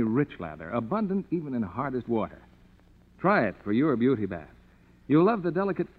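ordinary talk, nothing marked

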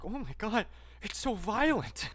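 mock shocked. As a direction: gasp it out, pearl-clutching and drawn out. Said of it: "being like".

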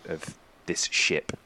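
normal talking voice

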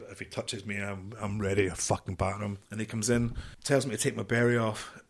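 ordinary talk, nothing marked

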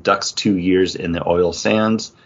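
normal talking voice

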